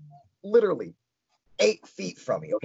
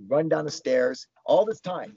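usual speaking voice

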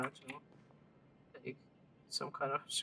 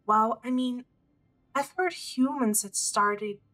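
lightly voiced eager scholar girl, higher; bright excited researcher voice, a bit higher; eager young scholar; 6 semitones higher, 4 dB louder than usual. Reads NPC Gina's dialogue aloud.

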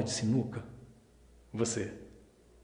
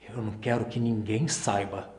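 low-key, softer plea for secrecy; quiet confiding; 3 semitones lower, 6 dB quieter than usual. Acted. Man confiding his secret to Luísa, quiet confiding. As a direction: say it softly, guardedly.

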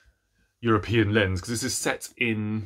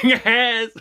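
higher and louder, eager mock yes; 12 semitones higher, 8 dB louder than usual. Booktuber admitting a fictional crush, eager loud yes. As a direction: blurt it out like a keen fan. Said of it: "but I'm like".

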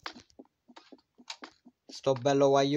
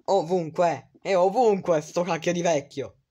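louder frustrated outburst; loud exasperated; 4 semitones higher, 6 dB louder than usual. Real, not acted. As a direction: stress ovunque, loud and exasperated. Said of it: "ovunque ripetuto più forte". (sbotta esasperato)

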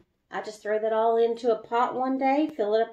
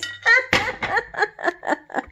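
embarrassed giggle; 11 semitones higher than usual. Real, not acted. Embarrassed at arm on camera.

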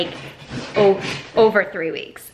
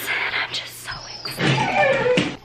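high, slow, whispered thrill; excited whisper; slower than usual. Real, not acted. Telling viewers about dinner and drinks, high excited whisper. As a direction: squeal it under her breath.

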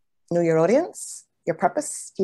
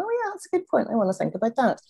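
a much higher, lightly voiced listener musing; imagined audience member; 6 semitones higher, faster than usual. Listener in the audience mulling a point, lightly voiced and much higher. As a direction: voice a listener catching a good point, pitched well up. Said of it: "they want".

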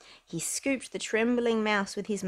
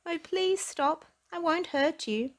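higher gentle reassuring voice; friendliest voice; 9 semitones higher than usual. Acted. Percy calming the mouse, gentle friendly higher voice. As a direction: soothe the frightened mouse. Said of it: "said in his friendliest voice".

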